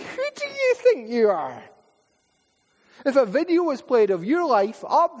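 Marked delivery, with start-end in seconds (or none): 0.0-1.6 much higher, mocking devil's voice; devil's taunt. The devil taunts a believer who dares to praise God. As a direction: sneer it down at them like the devil. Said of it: "the devil is saying".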